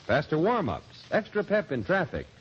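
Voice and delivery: ordinary talk, nothing marked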